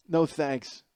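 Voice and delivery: usual speaking voice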